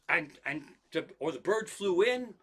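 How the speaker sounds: usual speaking voice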